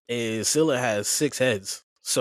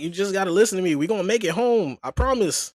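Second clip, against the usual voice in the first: higher, louder insistent plea; pleading promise; 8 semitones higher, 4 dB louder than usual. Retold or imitated. Odysseus promising the crew they will make it home, raised and urgent. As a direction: beg them to trust you.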